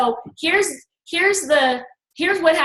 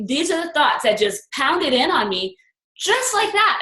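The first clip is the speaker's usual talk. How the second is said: ordinary delivery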